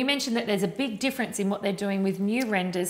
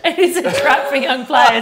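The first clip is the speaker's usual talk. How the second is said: amused, laughing through it; 3 semitones higher, 8 dB louder than usual. Real, not acted. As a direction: laugh while you agree. Laughing at shared mistake.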